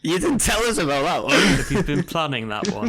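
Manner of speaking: amused laughing tease. Real, not acted. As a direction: giggle while teasing. Amused teasing over pun.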